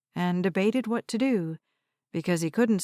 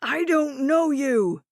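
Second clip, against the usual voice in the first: put-on angry old man; 6 semitones higher, 3 dB louder, slower than usual. Confused old man shouting.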